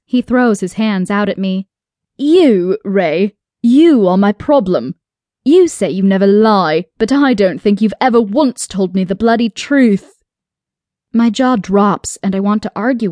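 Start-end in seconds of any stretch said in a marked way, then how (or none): 2.2-10.2 loud, punched exasperated outburst; heated, forceful confrontation; angry accusation. A narrator's reading. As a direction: throw the blame hard, voice jumping on key words.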